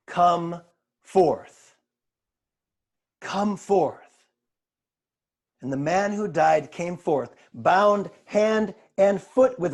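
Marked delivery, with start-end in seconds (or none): 0.1-4.0 deliberate summons, broken by weighty pauses; solemn loud command. A narrator's reading. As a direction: command him word by word. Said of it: "in a loud voice".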